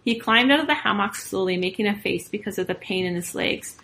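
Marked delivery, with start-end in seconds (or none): none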